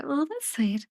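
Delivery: ordinary delivery, nothing marked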